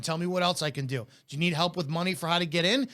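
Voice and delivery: ordinary talk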